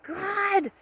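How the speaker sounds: high, drawn-out mock yell; imitating bird's yell. Owner imitating her bird copying her swearing, high yell. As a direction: mimic a bird crying out high. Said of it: "she would yell out".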